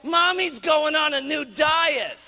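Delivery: loud, slow outraged announcement; husband tells the children about the diet; angry husband shout. Retold or imitated. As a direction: shout it to the kids in disgust. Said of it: "He says".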